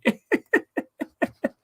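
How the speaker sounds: wheezing laughter; breathy high laugh. Real, not acted. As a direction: laugh almost silently. Still laughing.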